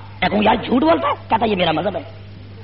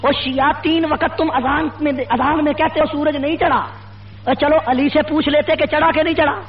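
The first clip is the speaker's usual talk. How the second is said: high-pitched, relentless rally oratory; fiery preaching; 5 semitones higher than usual. Real, not acted. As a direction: build accusation on accusation in rising, driving phrases. Preacher builds a fiery address. (جوشیلی، بلند سُر کی خطیبانہ للکار)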